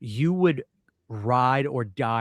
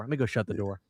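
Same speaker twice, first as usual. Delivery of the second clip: ordinary talk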